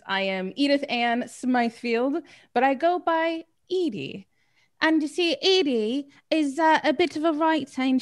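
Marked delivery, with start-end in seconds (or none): 4.8-8.0 put-on Cockney accent, pitched higher; accidental Cockney. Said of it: "I don't know why I went Cockney with that".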